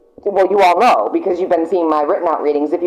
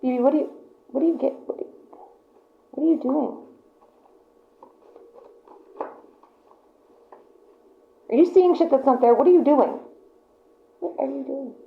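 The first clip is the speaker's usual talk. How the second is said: high, hushed talk to a pet; 7 semitones higher, 5 dB quieter, faster than usual. Real, not acted. Reader turns aside to her pet, high hushed coo. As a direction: coo at the pet under your breath.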